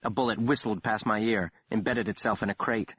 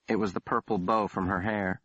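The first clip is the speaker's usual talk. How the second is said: ordinary delivery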